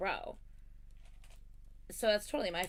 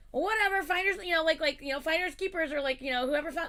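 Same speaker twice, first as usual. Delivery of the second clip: higher, louder careless brush-off; mock dismissive friend; 8 semitones higher, 7 dB louder than usual. Quoting Allison claiming finders keepers, mock dismissive tone. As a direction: play the friend shrugging it off.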